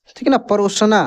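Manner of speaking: usual speaking voice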